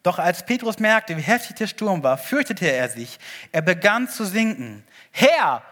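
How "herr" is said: loud desperate cry of Herr. Acted. Peter crying out to Jesus while sinking, loud desperate shout. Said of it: "schrie er".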